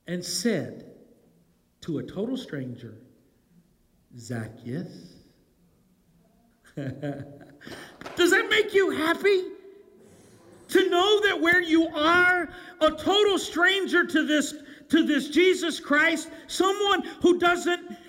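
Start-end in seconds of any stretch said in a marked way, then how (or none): 4.2-5.1 calling "Zacchaeus" much lower and softer, gently; name him softly like a friend; hushed, tender naming. Acted. Jesus calls the stranger by name. 6.8-13.4 laughing. Real, not acted. Preacher laughs, asking the crowd.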